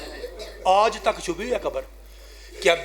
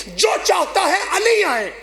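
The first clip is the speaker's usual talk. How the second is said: high impassioned cry; 13 semitones higher, slower than usual. Real, not acted. Preacher cries out to mourners.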